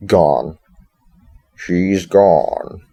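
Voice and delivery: drawn-out, punched bear voice; Freddy. Retold or imitated. Wristwatch bear announcing the child is gone, Freddy voice. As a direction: push it out loud like a mascot. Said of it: "I'm gonna keep doing that Freddy voice".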